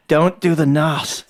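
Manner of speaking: slow, deliberate warning. Retold or imitated. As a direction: warn them slowly and gravely. Warning others.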